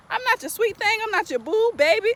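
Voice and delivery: high, indignant mock retort; sassy rebuttal. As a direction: put him in his place, pitched high. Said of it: "it's like".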